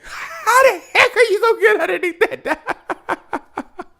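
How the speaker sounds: high, slow laughing disbelief; laughing at the impossible tackle. Real, not acted. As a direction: laugh through the question.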